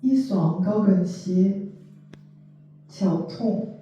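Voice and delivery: measured poetry reading, spaced line by line; poem recitation. Acted. Translator reading short poems to listeners, poem recitation. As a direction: recite it with deliberate pauses between phrases.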